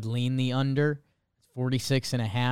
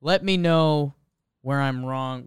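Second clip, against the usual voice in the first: ordinary talk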